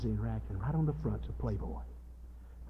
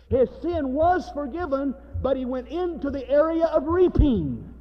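high, raised pulpit exposition; forceful preaching; 9 semitones higher, 7 dB louder than usual. Real, not acted. Preacher expounding David's reply to Nathan, forceful preaching. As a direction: drive the verse home with a lifted voice.

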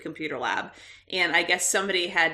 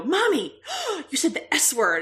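put-on tattling child; 8 semitones higher than usual. Kids quoted scolding mom.

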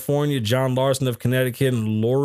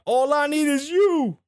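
high, slow put-on voice; mock yelling man; 14 semitones higher than usual. Host voicing the man yelling at Tyson, high and slow. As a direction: croon it like the obsessed fan. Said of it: "I'll do the man voice".

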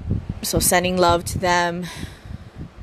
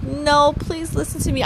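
higher, half-joking plea; mock pleading; 7 semitones higher than usual. Concierge retelling how she begs guests, mock pleading. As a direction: beg them lightly to listen. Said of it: "I'm actually done with the pleading".

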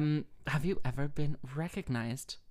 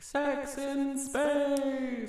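higher, very slow, stretched-out tease; teasing drawl; 11 semitones higher, much slower than usual. Pretend. Host teasing her guest about her talks, slow and higher. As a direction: draw out the words to tease her.

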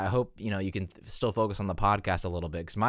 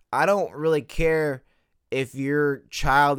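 louder, slow, higher rant; emphatic; 5 semitones higher, 6 dB louder, slower than usual. Real, not acted. Host ranting at honor-student stickers, louder and slower. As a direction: lean hard on every word.

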